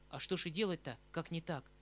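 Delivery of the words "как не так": ordinary delivery, nothing marked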